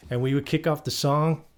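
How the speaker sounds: ordinary delivery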